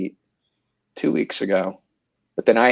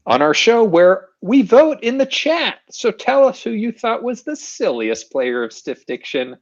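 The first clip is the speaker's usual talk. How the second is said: show host announce; 8 semitones higher than usual. Acted.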